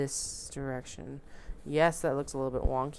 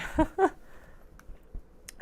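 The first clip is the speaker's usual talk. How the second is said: high giggle; 14 semitones higher, 4 dB louder than usual. Real, not acted. Laughing at own mistake.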